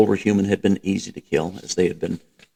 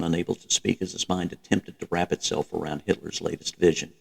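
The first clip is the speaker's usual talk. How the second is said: ordinary delivery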